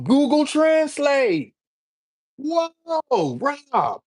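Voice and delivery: very high, drawn-out wonder; mock amazement. Showing viewers Google Translate, high mock amazement. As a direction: gush in high-pitched, stretched-out awe.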